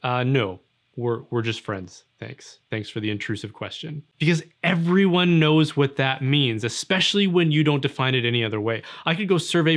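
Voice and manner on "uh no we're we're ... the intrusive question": low, fading, dry brush-off; awkward dismissal. Retold or imitated. Friend brushing off an intrusive server, low and dry. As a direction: mumble a sarcastic thanks. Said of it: "you would just say".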